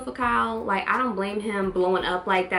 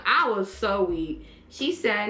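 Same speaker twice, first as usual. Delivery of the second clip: ordinary delivery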